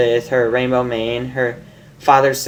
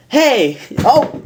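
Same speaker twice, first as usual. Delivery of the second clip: startled yelps, much higher; real surprise; 12 semitones higher, 3 dB louder than usual. Real, not acted. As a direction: caught off guard as the phone slips. Drops phone mid-reading.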